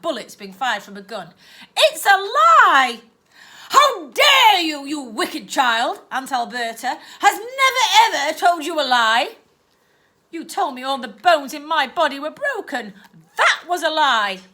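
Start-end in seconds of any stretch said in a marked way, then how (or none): 1.8-3.0 much higher and louder, slow cry of protest; accusing shout. Acted. Girl accuses aunt. 3.7-9.4 a wicked aunt bristling with indignation; aunt scolding the niece in fury; outraged scolding. Acted. 10.3-14.4 a touch higher and louder, insistent; girl arguing back. Acted.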